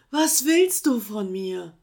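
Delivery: loud, slow desperate cry; desperate call. Acted. Sloth calling down in despair to the koala. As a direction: plead to be left alone. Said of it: "rief das Faultier irgendwann verzweifelt".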